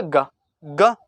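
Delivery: ग said twice, slow, voiced, no puff of air; g sound demo. Shown as a demonstration. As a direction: draw out ग gently without aspiration. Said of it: "ग के उच्चारण में कम वायु निकली". (ग धीरे, बिना हवा के)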